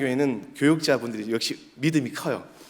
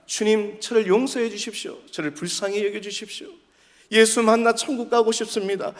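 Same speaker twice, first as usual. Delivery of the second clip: higher-pitched acted plea to God; pleading prayer; 8 semitones higher than usual. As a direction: lightly voice a pleading sinner. Voices a pleading sinner's prayer.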